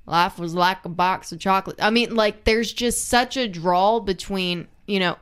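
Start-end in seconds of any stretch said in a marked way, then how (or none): 0.0-1.8 put-on Alabama Southern accent. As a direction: lean the Alabama Southern twang into "was". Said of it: "such a thick Alabama Southern accent".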